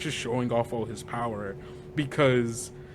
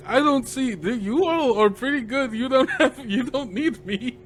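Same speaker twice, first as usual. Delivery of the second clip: high, whiny mock protest, pitched up through to a laugh; reluctant Ismark; 10 semitones higher, 3 dB louder than usual. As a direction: beg off the trip with a hammed-up whine. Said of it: "And he's like".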